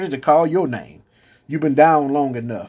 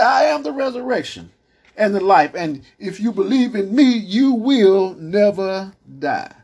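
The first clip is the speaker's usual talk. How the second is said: solemn proclamation; 7 semitones higher than usual. Acted. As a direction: proclaim it as Jesus, drawing out the end. Jesus proclaims, high and slow.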